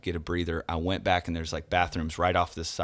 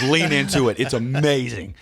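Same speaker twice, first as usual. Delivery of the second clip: loud, raised, enthusiastic pastor; excited encouragement; 6 semitones higher, 7 dB louder than usual. Retold or imitated. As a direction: cheer the singer on with gusto. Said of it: "I was like".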